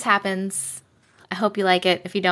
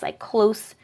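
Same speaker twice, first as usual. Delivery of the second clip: normal talking voice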